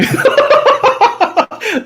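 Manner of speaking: long, loud, high cackle; high-pitched peals of laughter; amused demon laugh. Acted. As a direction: crack up loudly at a good jab. Demon laughing at the piper joke.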